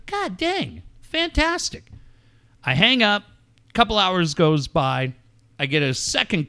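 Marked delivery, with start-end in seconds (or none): 0.1-1.9 mock delight. Sarcastic delight at rental code.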